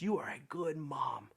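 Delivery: soft unvoiced whisper of comfort; whispered reassurance. A stranger whispers comfort to the frightened mom. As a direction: breathe it to her like a secret kindness. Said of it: "said simply".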